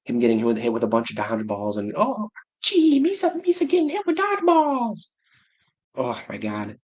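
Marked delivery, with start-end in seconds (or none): 2.0-5.1 high squeaky Jar Jar Binks voice; Jar Jar impression. Streamer imitating classmate who talked like Jar Jar, high cartoon voice. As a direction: mimic the kid doing Jar Jar, way up high. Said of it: "he talked like Jar Jar".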